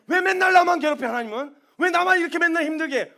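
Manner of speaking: whiny protest. Retold or imitated. Believer whining at God, loud and aggrieved. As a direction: protest like a wronged child.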